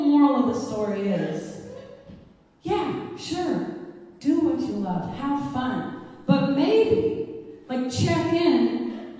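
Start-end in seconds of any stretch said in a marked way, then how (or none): none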